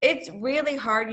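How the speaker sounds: usual speaking voice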